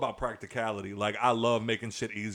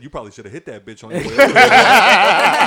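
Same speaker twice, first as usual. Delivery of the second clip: loud, high retort through laughter; laughing comeback; 6 semitones higher, 16 dB louder than usual. Real, not acted. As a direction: crack up while teasing her. Teasing reply, laughing.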